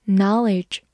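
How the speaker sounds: American-accented "knowledge"; American pronunciation. Shown as a demonstration. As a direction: say "knowledge" the American way, with an open first vowel. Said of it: "美式发音".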